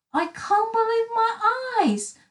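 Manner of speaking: very high, astonished character voice; amazed declaration. Acted. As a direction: proclaim your disbelief. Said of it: "declared Mister Greedy".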